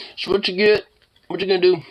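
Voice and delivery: high playful taunting; mock taunt. Real, not acted. Owner playfully taunting the pet.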